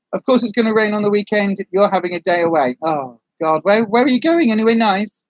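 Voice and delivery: usual speaking voice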